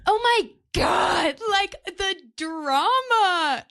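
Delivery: drawn-out, emphatic fan gushing. Real, not acted. Viewer raving to friends about the drama, gushing. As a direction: gush slowly, stretching out the big words. Gushing over a favourite series.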